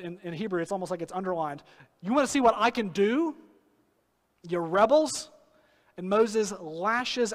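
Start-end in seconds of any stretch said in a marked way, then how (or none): 2.0-5.3 louder, higher jeering challenge; angry taunt. Retold or imitated. Moses taunting the rebels before striking, angry shout. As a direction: throw it at them with contempt. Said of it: "yells at the people".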